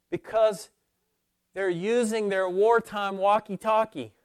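high, emphatic preacher's declaration; forceful preaching. Real, not acted. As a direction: hammer the point home to the congregation. Preacher drives home his point.

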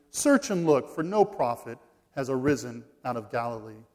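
a loud, dramatic reading of the passage; scripture reading. Acted. Pastor reading John seven to the congregation, dramatic reading.

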